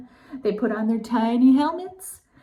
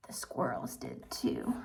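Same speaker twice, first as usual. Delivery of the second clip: hushed whisper; 12 semitones lower, 15 dB quieter than usual. A narrator's reading. Narrator whispering to kids about the squirrels. Story reveal, hushed aside.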